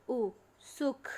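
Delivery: सुख with a short clipped ऊ, said slowly; short u shown. Shown as a demonstration. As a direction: stretch out ऊ then say सुख with a quick short u. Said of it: "बोलकर ऊ और ऊ की मात्रा का अंतर जानो". (सुख में छोटा ऊ, धीरे और साफ़)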